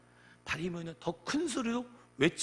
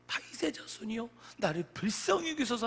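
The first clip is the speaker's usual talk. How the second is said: higher-pitched imploring call; imitated cry for mercy, pitched up; retold crying out; 6 semitones higher than usual. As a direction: lift the beggar's plea up high. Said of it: "하면서 정말로 부르짖었어요".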